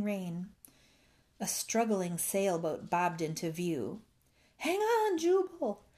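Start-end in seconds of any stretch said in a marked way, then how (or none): 4.6-5.8 high, urgent holler; anxious call. A narrator's reading. Rescuer to stranded bullfrog, calling anxiously. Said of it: "an anxious voice called".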